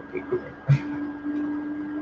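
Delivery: slow whispered come here; soft coaxing whisper. Retold or imitated. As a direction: whisper gently to a child. Coaxing the kids.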